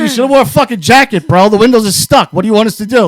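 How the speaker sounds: loud, high-pitched mock exasperation; comic yelling over the friend's complaint; retold shouted protest. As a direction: shout back as if helplessly innocent. Retold teasing shouted reply.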